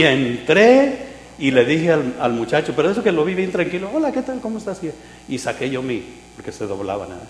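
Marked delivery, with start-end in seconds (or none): 3.9-4.8 high-pitched, carefree hello; retold innocent greeting in a raised voice; breezy greeting. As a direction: sing out a light, high hello. Retells his casual greeting to shop clerk.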